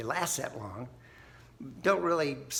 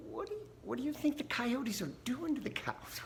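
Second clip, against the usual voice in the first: much higher mock-incredulous question; dry farmer punchline; 11 semitones higher, 5 dB quieter than usual. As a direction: deliver the punchline as a wry farmer.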